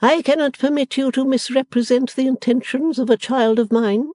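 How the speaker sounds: high, loud stately matron command; Mrs Wilfer's order. Acted. Stately mother orders the servant onward, imperious. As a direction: proclaim it like a grand lady. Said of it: "she began".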